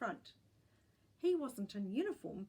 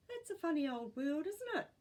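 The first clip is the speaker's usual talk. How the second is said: lightly voiced old great-gran; 8 semitones higher, 4 dB louder than usual. A narrator's reading.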